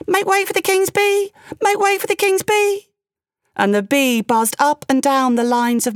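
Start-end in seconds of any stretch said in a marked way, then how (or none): 0.1-2.9 very high-pitched little bee voice; tiny piping voice. A narrator's reading. Tiny bee announces itself to the king, high and piping. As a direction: pipe up like a tiny creature. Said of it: "a tiny voice piped".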